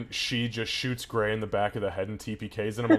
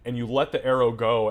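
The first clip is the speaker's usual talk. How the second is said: ordinary talk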